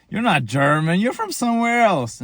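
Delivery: imitated doubters, pitch swooping up high; mock disbelieving objection. Germans rejecting his claim to be German, mock incredulous. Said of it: "and they be like".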